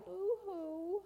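high, drawn-out pretend wail; hammed-up boo-hoo; mock crying. Storyteller voices frightened boy, mock crying.

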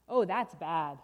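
loud, low groan of dismay; dismayed refrain. Acted. Storyteller reacts to burst balloon, loud dismay. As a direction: lament the bad news heavily.

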